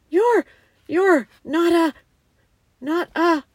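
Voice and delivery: high, halting, fearful question; shaky voice. A narrator's reading. Susan fears the lion is a ghost, shaky. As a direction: falter and break off, afraid to finish. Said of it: "asked Susan in a shaky voice".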